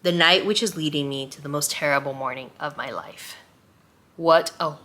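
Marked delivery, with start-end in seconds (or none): 0.0-3.4 reading aloud. Acted. Reviewer reads the novel's first line to viewers, hushed and solemn.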